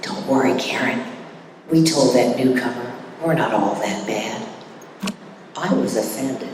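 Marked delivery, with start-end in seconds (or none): 0.0-4.4 a lightly voiced woman, lower and softer; a hint of a soothing fellow member; reassuring gal. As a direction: imitate the gal brushing it off kindly. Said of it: "she goes".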